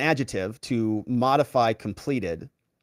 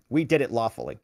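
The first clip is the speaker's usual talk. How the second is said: usual speaking voice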